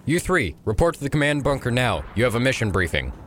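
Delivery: a touch of military command; officer giving orders. Acted. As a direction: order soldiers to report, lightly voiced. Officer orders three soldiers out.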